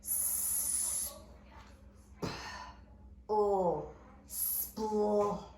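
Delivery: s, p, o, spl sounded out separately and softly; teacher blending the sounds; sounding out. Shown as a demonstration. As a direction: segment the blend slowly.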